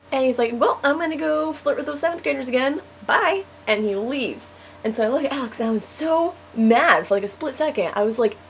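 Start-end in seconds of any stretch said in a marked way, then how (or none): 0.5-3.4 breezy announcement. Retold or imitated. Imitates boy breezily leaving.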